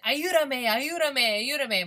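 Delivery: begging in Spanish. Retold or imitated. Scared tourist begging the attendant for help, pleading. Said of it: "I am sitting there begging".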